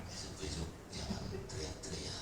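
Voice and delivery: slow, soft whisper. Retold or imitated. Pastor whispers to the sick husband, slow soft whisper. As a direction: breathe it gently to the patient. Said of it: "Saya bisikin".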